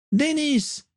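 very high-pitched acted yell; high captive girl's cry. A narrator's reading. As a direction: cry his name out high, without added volume. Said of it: "hurlait encore Caitlin".

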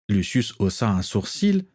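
plain reading voice

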